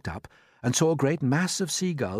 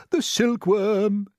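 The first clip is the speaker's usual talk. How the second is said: high, loud, drawn-out exclamation; excited cry; 11 semitones higher, 6 dB louder, much slower than usual. A narrator's reading. Old grasshopper revealing where string comes from, crying out. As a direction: cry it out, stretching the words. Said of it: "cried the old green grasshopper".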